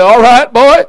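lightly acted voice of God, loud and high; God responding. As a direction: boom out a loud, quick answer. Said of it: "And God responded and said".

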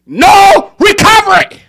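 shouted refrain. Real, not acted. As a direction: shout No recovery slowly.

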